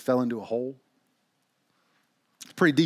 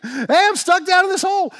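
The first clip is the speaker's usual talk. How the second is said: very high, loud holler for help; yelling; 10 dB louder than usual. Acted. Bob, still trapped, calls for anyone, yelling. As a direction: keep hollering up from the hole. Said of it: "Bob keeps yelling".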